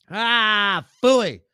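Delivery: loud, high mock scoff; Lenny scoff. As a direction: blurt it out like a sore sidekick. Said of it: "Lenny cuts in".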